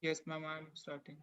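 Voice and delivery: very faint, distant voice; almost lost, far from the mic; barely audible. Real, not acted. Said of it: "but your voice is very low".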